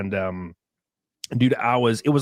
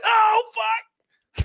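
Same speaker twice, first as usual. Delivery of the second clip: choked-up surprise. Retold or imitated. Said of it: "I'm just like".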